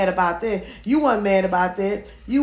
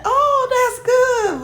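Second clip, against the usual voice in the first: drawn-out, much higher mock gush; fake gushing; 4 dB louder, much slower than usual. Vlogger mocking the woman's fake delight, slow high gush. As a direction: imitate her faking delight, stretched slow. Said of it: "But it was a fake".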